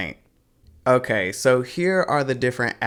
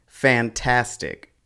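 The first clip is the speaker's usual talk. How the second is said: slow fantastic as fan-TAS-tic; fantastic, weight on the second syllable; correct middle stress; slower than usual. Shown as a demonstration. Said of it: "The stress falls on the second syllable".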